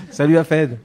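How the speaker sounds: laughing. Real, not acted. Laughing greeting an old friend.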